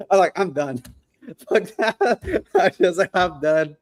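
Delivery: mock giving up, breaking into laughter; laughing exasperation. Telling a colleague about quitting his own dispo, laughing exasperation. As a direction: retell it high-pitched, laughing through the words.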